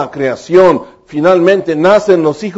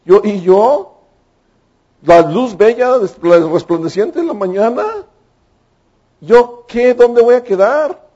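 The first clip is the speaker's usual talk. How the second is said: a hint of a vain, put-out angel; jealous angel; 4 semitones higher than usual. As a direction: whine a little about being left out. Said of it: "Uno de ellos dijo".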